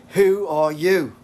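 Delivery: high, loud, drawn-out demand. Acted. Character challenging a stranger's identity, loud drawn-out demand. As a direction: stretch each word out, loud and high.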